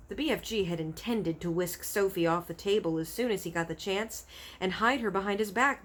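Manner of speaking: plain reading voice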